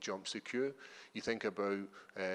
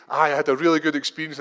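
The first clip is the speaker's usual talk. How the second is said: loud, higher mock interview answer; acting himself interviewed; 6 semitones higher, 16 dB louder than usual. Fighter acting out his answer for a translator, loud and higher. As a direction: give a mock post-fight answer, raised voice. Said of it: "Like, I'm gonna say".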